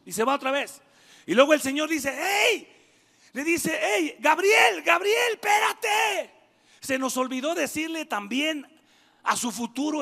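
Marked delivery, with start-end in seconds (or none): 2.2-6.3 calling out. Acted. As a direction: shout after Gabriel to wait. God calling back the angel.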